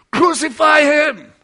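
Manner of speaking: long, loud, high cry of crucify; crowd shout. Retold or imitated. Preacher voicing the mob calling to Pilate, slow shout. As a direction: bellow it like an angry mob.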